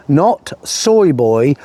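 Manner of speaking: put-on "not soy boy" said slowly, word by word; deliberate slow stress on "soy"; emphatic correction. Shown as a demonstration. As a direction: draw out "soy boy" to mark the contrast.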